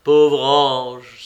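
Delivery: loud, pained cry. A narrator's reading. Léonie cries out to Juliette in pity, loudly. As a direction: burst out with pity. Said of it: "s'écria-t-il enfin".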